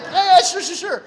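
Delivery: high, loud rush of yeses; hammed-up loud, high assent; mock eager agreement. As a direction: imitate medics agreeing in a hurry. Medics hurriedly agree to prayer.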